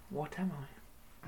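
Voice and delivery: hushed riddle question; whisper. Acted. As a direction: breathe it out unvoiced.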